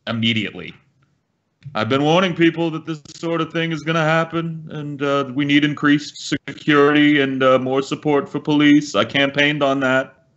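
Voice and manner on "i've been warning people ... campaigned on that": lightly higher blustering Trump boast; self-congratulating Trump, a bit higher; Trump impression. Friend imitating Trump spinning the tragedy, higher self-praising. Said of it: "he would've come out and spun this".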